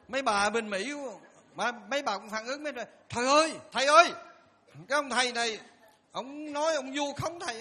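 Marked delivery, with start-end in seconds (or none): 3.1-7.6 excited calling out. Retold or imitated. Women rushing to report.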